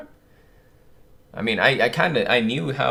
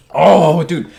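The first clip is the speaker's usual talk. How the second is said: loud shocked oh dude; 4 semitones higher, 10 dB louder than usual. Real, not acted. Another reveal hits him.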